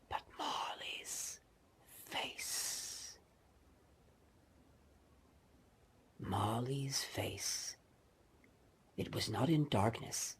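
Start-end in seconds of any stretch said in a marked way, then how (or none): 0.1-7.8 slow, breathy ghost-story whisper; drawn-out spooky hush; eerie whisper. A narrator's reading. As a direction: hush it like a secret in the dark.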